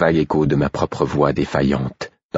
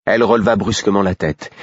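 ordinary delivery, nothing marked

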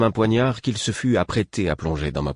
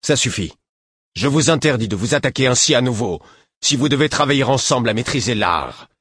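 firm command; 5 semitones higher, 5 dB louder than usual. A narrator's reading. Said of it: "ma voix ne tremblait pas".

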